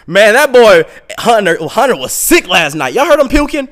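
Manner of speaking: high, shouted gossip; imitated hostel mate, loud and high-pitched; loud roommate. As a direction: holler it across the room. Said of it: "And all I hear is".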